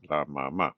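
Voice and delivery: "mamá" stressed on the final má; soft closing drill item, "mamá" weighted on the end; stress on last syllable. Shown as a demonstration. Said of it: "a note on pronunciation".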